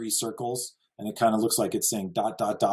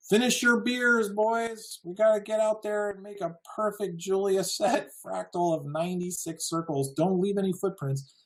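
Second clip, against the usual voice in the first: much higher, put-on voice of a cheeky lad rallying mates; mock rowdy lads; 9 semitones higher than usual. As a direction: ham up a boozy lad egging his friends on. Mock quote of imagined pranksters.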